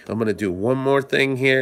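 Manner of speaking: normal talking voice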